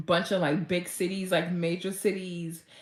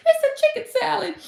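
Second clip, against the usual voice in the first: very high, near-crying delight; mock tearful joy; 7 dB louder than usual. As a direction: squeak it out overcome with happiness. Overjoyed at imagined fame.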